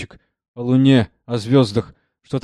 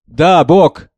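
loud fervent shout; 5 semitones higher, 9 dB louder than usual. A narrator's reading. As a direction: fire it straight back at her. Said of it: "закричал и Мусорский".

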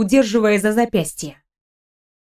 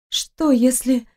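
slow, higher, hesitant woman's line; anxious, unfinished question; 4 semitones higher, much slower than usual. A narrator's reading. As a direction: break off hesitantly from nerves. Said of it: "не смогла договорить, бледнее от волнения". (медленно, с волнением, не договаривая)

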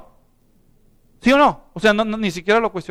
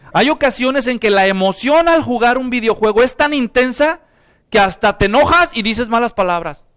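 ordinary talk, nothing marked

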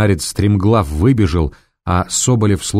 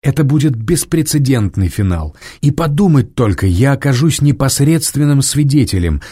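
breathless with thrill; thrilled about the event; excited; 6 semitones higher than usual. A narrator's reading. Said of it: "взволнованно молвил журналист".

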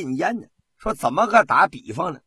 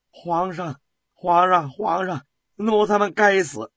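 begging for mercy; 6 semitones higher, 4 dB louder than usual. A narrator's reading. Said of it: "太监们哭了起来，连声的求饶".

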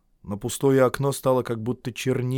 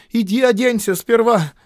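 higher, louder call from the porch; 11 semitones higher, 8 dB louder than usual. A narrator's reading. Nadezhda calls from the porch to Iona, louder. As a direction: call after him sharply.

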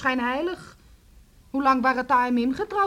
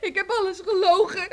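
crying defiance; 6 semitones higher than usual. Acted. Daughter cries defiantly.

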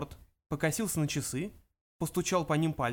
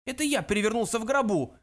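put-on high, loud old man's bark; angry ancestor's outburst; 7 semitones higher, 5 dB louder than usual. A narrator's reading. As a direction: snap it out in indignation. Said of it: "рявкнул предок".

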